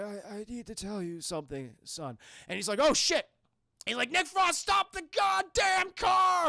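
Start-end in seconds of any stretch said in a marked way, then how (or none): 0.0-2.2 weakly confiding. Acted. Ailing stepfather needs to tell his son something, weakly confiding. 2.8-3.3 high, loud burst of dismay; acted alarm. Stepson reacts to his stepfather's confession, alarmed. 4.1-6.5 high, loud, stretched-out yell; acted frantic shout. Passenger orders reckless driver to stop, frantic shout.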